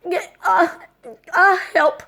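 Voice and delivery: high, strained cry for help; panicked plea. Retold or imitated. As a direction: squeak out a helpless plea. Retold panic calling help.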